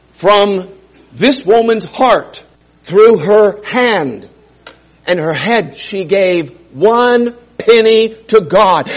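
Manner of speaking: loud, slow, emphatic preaching; building sermon climax, loud and slow; impassioned preaching. Acted. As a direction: lean in and preach every word. Preaching at full force.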